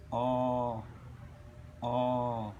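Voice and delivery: low, held "O"; sustained teaching "O", repeated; long vowel demonstration. Shown as a demonstration. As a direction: draw each "O" out evenly. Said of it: "Now I will pronounce".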